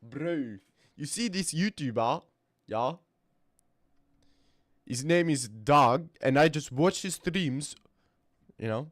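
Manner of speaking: imitating a South African store customer; South African accent. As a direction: chat casually in a put-on South African accent. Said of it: "Average Hackle interaction at the store".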